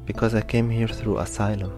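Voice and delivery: plain reading voice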